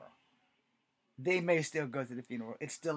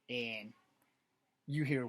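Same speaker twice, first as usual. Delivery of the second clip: usual speaking voice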